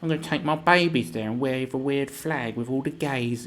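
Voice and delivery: sing-song caricature of a do-gooder; mocked protester. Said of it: "These people who go".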